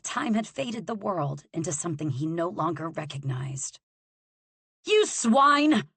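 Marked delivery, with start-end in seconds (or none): 4.8-5.9 much higher, louder jeer; a noble jeering at a prisoner, shouting; yelled insult. Acted. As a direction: hurl the insult like a stone. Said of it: "a member of the gentry yelled".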